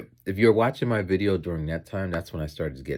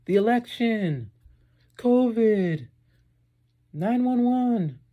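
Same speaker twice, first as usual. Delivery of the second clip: put-on much higher, slow kid voices listing answers; imitating kids; 5 dB louder, much slower than usual. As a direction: mimic eager schoolkids, drawn out. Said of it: "And some kids".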